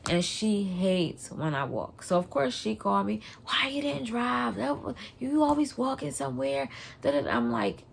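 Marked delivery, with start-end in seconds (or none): 3.5-6.7 nagging wife. Retold or imitated. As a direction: imitate a wife scolding, pitched up. Wife scolds her for walking.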